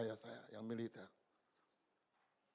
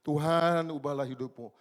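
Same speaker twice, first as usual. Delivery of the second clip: a louder, more insistent cry of Tuhan; repeated plea, louder; 10 dB louder, slower than usual. Retold or imitated. Preacher repeating the prayer, louder and more insistent. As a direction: push the plea harder on Tuhan. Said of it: "Berulang-ulang saya bilang".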